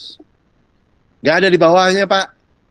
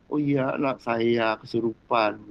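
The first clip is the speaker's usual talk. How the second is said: low, slow, soft confession; subdued reply; 9 semitones lower, 6 dB quieter, slower than usual. Retold or imitated. Woman telling the Lord about her child, low and subdued. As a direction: answer quietly and slowly, pitched low.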